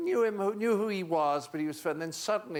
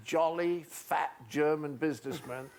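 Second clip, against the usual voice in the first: ordinary talk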